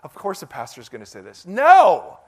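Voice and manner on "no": high, loud burst of protest; one sudden loud no; emphatic protest. Real, not acted. Pastor rebutting the idea it's just pastor talk, emphatic protest. Rejects the cheesy-pastor objection.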